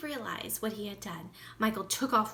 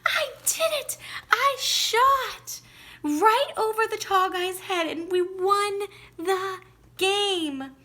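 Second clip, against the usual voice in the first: very high, loud triumphant shout; excited shout; 10 semitones higher, 8 dB louder than usual. A narrator's reading. Boy bursting in to tell daddy, ecstatic shout. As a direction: scream the news with joy. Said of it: "he shouted".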